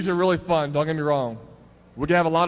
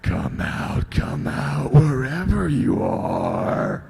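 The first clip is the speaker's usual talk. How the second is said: creepy killer; 2 semitones lower, 4 dB louder, much slower than usual. Acted. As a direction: taunt like a stalking killer. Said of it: "I'll be the bad guy or the serial killer".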